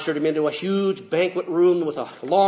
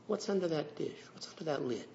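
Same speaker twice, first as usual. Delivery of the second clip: hushed wondering; 11 dB quieter than usual. Acted. Man wonders to himself.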